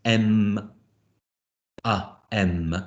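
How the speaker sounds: "M, A, M" with em for each M, separated by pauses; "M, A, M" segmented wrongly as em, uh, em; impure sounds. Shown as a demonstration. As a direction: sound out "M, A, M" using em, not a hum. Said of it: "If you're pronouncing the M".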